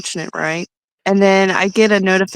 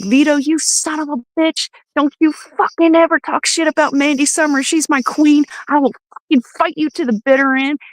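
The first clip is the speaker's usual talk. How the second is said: retold rant; 6 semitones higher than usual. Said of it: "I lost my fucking shit".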